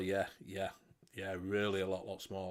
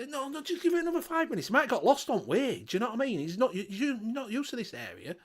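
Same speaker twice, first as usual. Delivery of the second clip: high, fast, pleading voice for the dog owner; a jokey high-pitched plea to the barman; 10 semitones higher than usual. Acted. Dog owner reassuring barman in joke.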